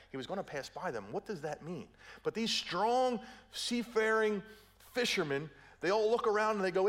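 usual speaking voice

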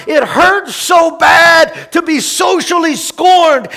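loud, high, pained shout; shouted anguish. Acted. Pastor voicing Jesus's imagined cry, shouted anguish. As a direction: shout the pain out. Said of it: "He doesn't cry out".

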